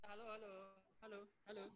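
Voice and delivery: barely audible call over a weak line; faint voice. Real, not acted. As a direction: call out faintly, far from the mic. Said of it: "Suaranya kecil sekali".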